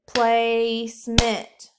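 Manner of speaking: Placement split into slow, loud syllables; clapped syllables. Shown as a demonstration. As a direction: clap Place-ment slowly. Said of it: "Clap it".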